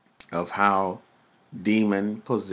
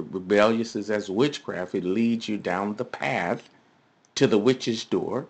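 usual speaking voice

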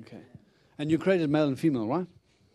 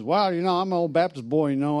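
loud, higher-pitched attempt at a country accent; old country accent; 4 semitones higher, 7 dB louder than usual. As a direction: imitate an old country Baptist boy's accent. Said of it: "with his accent, like, I can't do that accent, but he's got this old country accent".

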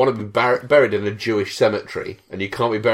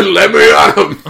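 high, loud shout; loud mock battle cry; eager battle cry; 7 semitones higher, 9 dB louder than usual. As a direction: charge in yelling. Charging at ghosts.

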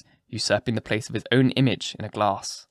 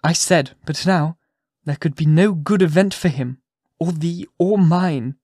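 higher-pitched, impassioned appeal; earnest plea; 5 semitones higher, 4 dB louder than usual. A narrator's reading. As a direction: plead with him, keeping the pitch raised. Hester pleads for forgiveness.

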